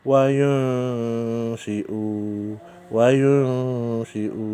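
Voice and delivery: "Wa yunshi'u" very slow, the n hummed into sh; Quran recitation. Shown as a demonstration. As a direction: chant "wa yunshi'u" drawn out. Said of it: "nun mati ketemu shin". (lantunkan "wa yunshi'u" sangat pelan dengan dengung)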